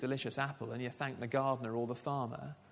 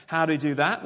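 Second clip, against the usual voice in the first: louder, rising rhetorical question; emphatic question; 3 semitones higher, 10 dB louder, faster than usual. Shown as a demonstration. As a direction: throw the question out to the room with a rising end.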